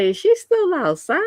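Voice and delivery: high, disbelieving question; can't believe she's still out there; incredulous. Retold or imitated. Retelling her shock at Misty staying out all night, disbelieving.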